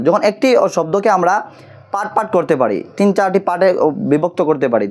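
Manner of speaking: ordinary talk, nothing marked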